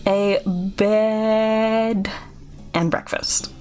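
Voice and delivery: oddly paced, with key words drawn out; creepy innkeeper. Acted. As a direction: explain yourself haltingly, leaning on odd words. Voices Chuck explaining his lisp.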